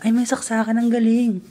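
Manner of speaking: high excited discovery; pleasantly surprised. Real, not acted. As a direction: react with glee. Discovers outlets. (masayang gulat)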